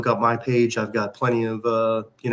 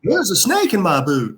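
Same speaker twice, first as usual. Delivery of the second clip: Woody impression; 8 semitones higher, 5 dB louder than usual. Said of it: "Or there".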